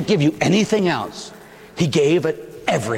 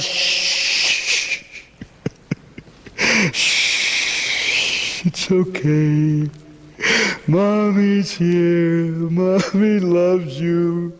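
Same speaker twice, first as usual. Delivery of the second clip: slow tender hushing; much slower than usual. Acted. Mary soothing dead son.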